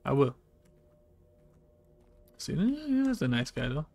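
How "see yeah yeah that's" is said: excited squeal. Real, not acted. Reacting to game character.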